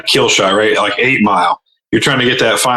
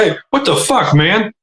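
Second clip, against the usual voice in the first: high-pitched offended protest; mock affronted; 9 semitones higher, slower than usual. The insulted person answers back, high mock affront. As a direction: imitate someone taken aback by an insult. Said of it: "that person's like".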